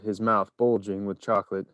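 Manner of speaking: plain reading voice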